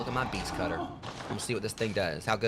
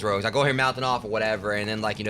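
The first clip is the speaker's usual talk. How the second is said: normal talking voice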